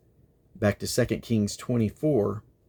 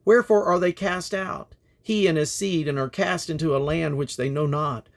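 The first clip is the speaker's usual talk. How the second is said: raised, grave declaiming of prophecy; prophetic reading; 4 semitones higher than usual. Acted. Reader voicing God's judgment, grave declaiming. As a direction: proclaim judgment with rising force.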